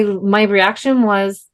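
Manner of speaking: normal talking voice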